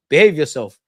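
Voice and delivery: loud scolding at the screen; stern telling-off. Real, not acted. Fan scolding his player, loud and stern. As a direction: bark it at a misbehaving player.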